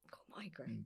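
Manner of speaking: breathy whisper, feeble and sick; whispered fake excuse. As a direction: whisper it like you're ill. Faking migraine excuse.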